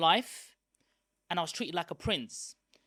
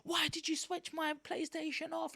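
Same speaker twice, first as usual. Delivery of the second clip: much higher, whiny protest over the PlayStation; retold angry outburst; 11 semitones higher than usual. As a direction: replay a kid's furious whine, pitched up. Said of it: "Like, I'm getting angry".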